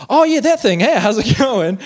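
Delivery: high, loud pretend recognition; mock enthusiasm. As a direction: play up a sudden keen "oh, that". Acts out himself bluffing on phone.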